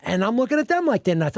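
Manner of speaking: ordinary talk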